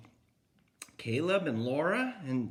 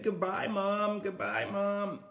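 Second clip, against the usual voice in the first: higher, sing-song piglet goodbye; little pigs; 6 semitones higher than usual. Acted. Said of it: "they said".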